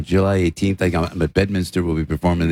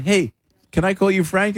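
light cheeky tone; 10 semitones higher than usual. Retold or imitated. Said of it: "And I went".

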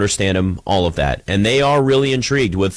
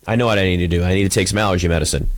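usual speaking voice

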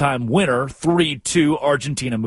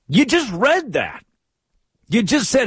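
incredulous; 9 semitones higher than usual. Real, not acted. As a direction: stress the obvious. Incredulous at the quote.

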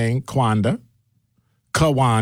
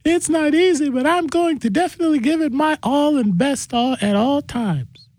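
much higher, put-on voice of a caller thanking the host; Kawanda voice. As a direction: squeak it out as the grateful listener Kawanda. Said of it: "that's my Kawanda voice".

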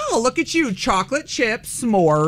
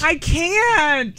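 exasperated cry; 8 semitones higher than usual. Real, not acted. Reacting to forty bananas.

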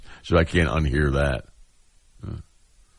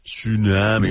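louder, higher mock southern drawl on "Tsunami"; mock southern slur; 4 semitones higher, 4 dB louder, slower than usual. Cohost recalling how the actress said tsunami, mock southern slur. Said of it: "how'd she say tsunami again?".

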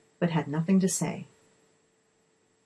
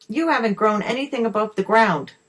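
mildly outraged creature, higher and louder; angry outburst; 5 semitones higher, 5 dB louder than usual. A narrator's reading. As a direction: protest crossly, voice pushed up. Boggart flushed, scolds the lady's harvest.

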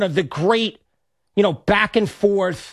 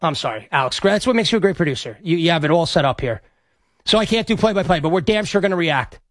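ordinary talk, nothing marked

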